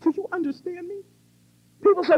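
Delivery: ordinary talk, nothing marked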